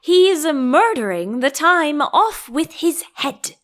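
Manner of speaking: hammed-up queen's shout; bawled. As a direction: bawl it out like a furious queen. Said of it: "the Queen bawled out".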